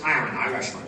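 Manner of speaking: low Scottish film-star voice; Sean Connery. Retold or imitated. Film star playing an Irishman, deep Scottish impression. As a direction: drop deep into unchanged, slushy Scottish. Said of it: "But he says".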